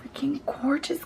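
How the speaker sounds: breathy, awed whisper. Real, not acted. Admiring the shade to viewers, awed whisper. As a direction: hush it out in awe.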